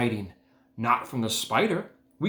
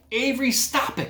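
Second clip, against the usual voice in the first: much higher scolding mother; mother crying out; 13 semitones higher than usual. A narrator's reading. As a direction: call the boy to order. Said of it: "cried his mother".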